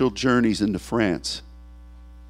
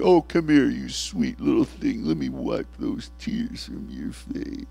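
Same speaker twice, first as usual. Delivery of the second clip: tender comfort; 5 dB quieter than usual. Pretend. God comforting a weeping believer, soft and tender. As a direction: soothe a crying child. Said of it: "we're gonna be standing there".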